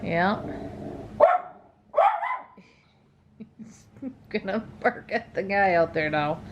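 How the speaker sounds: ordinary delivery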